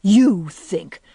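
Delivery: scornful, with a loud hit on "you"; contemptuous echo; utmost contempt. A narrator's reading. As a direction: throw it back with disdain. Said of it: "voice expressed the utmost contempt".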